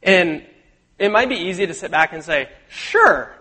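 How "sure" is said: high-pitched, falling, louder "sure"; imitated glib concession; breezy mock agreement. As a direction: toss out an easy agreement, pitched way up. Imagined listener easily agreeing.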